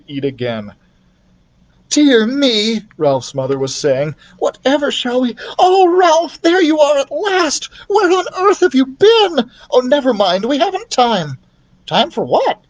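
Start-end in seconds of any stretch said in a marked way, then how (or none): 1.9-2.9 high, louder flustered mother; worried mother mouse; high fussy mother voice; fretting mother mouse. Acted. 4.4-11.4 very high, loud flustered mother; mother mouse in a panic; shrieking worried mother mouse; frantic mother mouse. Acted. 11.8-12.6 high puzzled mouse; high squeak, puzzled. Acted. Ralph voiced.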